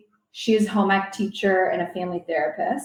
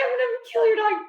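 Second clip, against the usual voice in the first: shrieking much higher, a lightly voiced angry Nona; mock furious grandma; much faster than usual. Nona threatening the dog while swinging a shoe, in a high mock-furious shriek. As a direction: play the grandma on the warpath, pitched way up. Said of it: "She's just, like, swinging".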